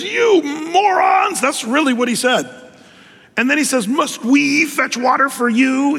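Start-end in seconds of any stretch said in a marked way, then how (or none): 0.0-1.4 slow, high, loud furious shout; angry Moses. Retold or imitated. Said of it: "He's, he's ticked". 3.9-6.0 high, slow angry sneer; ticked off Moses. Retold or imitated. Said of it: "He's, he's ticked".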